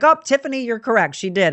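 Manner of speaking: a bright retold "you're right!"; excited exclaim. Cashier confirming to coworker, mock excited.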